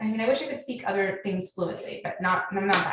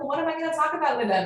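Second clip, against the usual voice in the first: higher, fast, put-on voice of the husband; a bewildered husband complaining to his wife, higher and rushed; husband's whiny protest; 7 semitones higher, faster than usual. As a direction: play the lost husband pleading, quick and higher. Husband protesting at family gathering.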